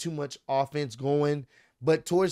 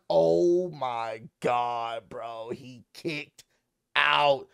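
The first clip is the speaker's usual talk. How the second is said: slow, lower, drawn-out awe; awed disbelief; 5 semitones lower, much slower than usual. Retold or imitated. Watching the false finish on the show, slow stunned awe. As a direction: stretch it out, stunned.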